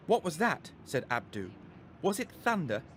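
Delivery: put-on boy asking his mum about the bang; startled boy. A narrator's reading. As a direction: jump at a strange noise.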